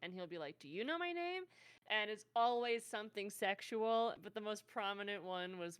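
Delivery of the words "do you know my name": forgetful skeleton voice. Acted. Skeleton NPC asking party.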